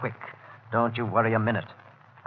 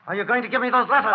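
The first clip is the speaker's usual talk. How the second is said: menacing demand; 9 semitones higher, faster than usual. Acted. Threatening her for the letters.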